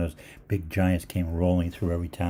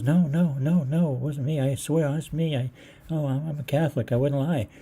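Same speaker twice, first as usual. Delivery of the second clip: pitched-up mock woman's voice, pleading; flustered lady; 8 semitones higher than usual. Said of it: "so she goes".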